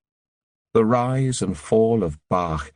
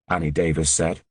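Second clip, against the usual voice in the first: ordinary delivery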